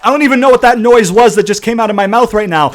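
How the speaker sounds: loud, high-pitched real rant; frustrated yelling. Real, not acted. Gamer raging at what the AI does, frustrated yelling. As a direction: yell it out, utterly exasperated. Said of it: "It's just frustration".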